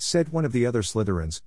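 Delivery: ordinary delivery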